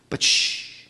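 a soft unvoiced shh; whispered shush. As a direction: hush him gently. Jesus hushes healed leper.

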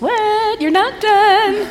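high teasing voice, slowing down; sing-song tease: you're not done; playful voice of the Lord. Retold or imitated. The Lord teasing a tired mother, high and playful. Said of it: "and the Lord said".

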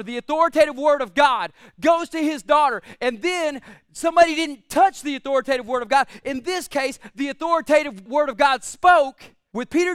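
loud, driving sermon delivery; preaching at full force. Acted. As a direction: build the congregation up. Preaching climax.

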